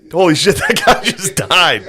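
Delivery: shocked disbelief breaking into a laugh; laughing retelling. Retold or imitated. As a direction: tell it half laughing at the shock. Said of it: "I was just like".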